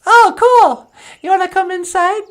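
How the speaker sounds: very high, bright greeting; a cheerful high puppet hello; [name]'s puppet voice. Acted. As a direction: pitch way up for the puppet.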